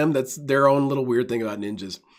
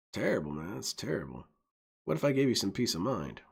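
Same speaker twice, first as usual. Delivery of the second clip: shady dealer; 5 dB quieter than usual. Retold or imitated. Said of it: "What they basically say is".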